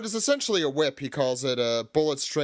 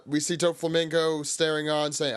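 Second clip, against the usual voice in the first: ordinary delivery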